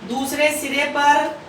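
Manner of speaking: slow, measured dictation with long pauses. Acted. Teacher dictating notes slowly to students, slow and measured. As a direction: spell it out word by word for note-taking.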